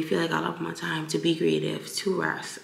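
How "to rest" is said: normal talking voice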